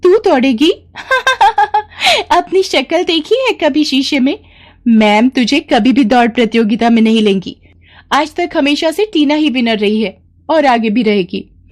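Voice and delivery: put-on high, jeering child voices with a laugh; mocking schoolkids. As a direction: taunt her like a smug classmate. Kids mocking Palak.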